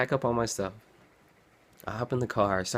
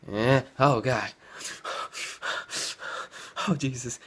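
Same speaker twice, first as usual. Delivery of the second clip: exhausted panic; 4 semitones higher than usual. Retold or imitated. Sleepy driver on a dark road, groaning with dread. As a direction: moan it like you're about to nod off. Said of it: "I'm like".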